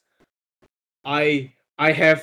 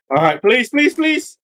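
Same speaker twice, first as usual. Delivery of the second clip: pleading; 8 semitones higher, 6 dB louder than usual. Real, not acted. Begging for a good roll.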